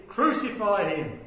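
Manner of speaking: loud, slow mob cry; angry crowd demanding a crucifixion, loud slow shout. A narrator's reading. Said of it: "cried out the more exceedingly".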